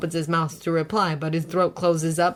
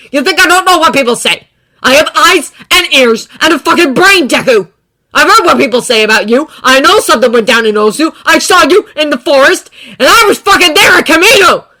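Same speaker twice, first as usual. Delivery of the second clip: angry; 9 semitones higher, 16 dB louder than usual. A narrator's reading. Bakugo shouting.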